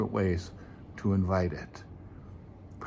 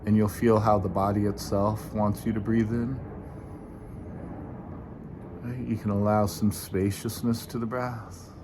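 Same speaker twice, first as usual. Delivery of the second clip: guided meditation; 5 dB quieter than usual. Acted. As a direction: ease listeners gently into the breath. Leads a meditation.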